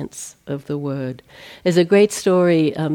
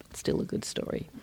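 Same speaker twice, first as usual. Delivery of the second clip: unvoiced, much softer throwaway; whispered aside; 13 dB quieter, faster than usual. Real, not acted. Teacher murmuring an aside before the grocery story, whispered. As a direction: tuck it under your breath.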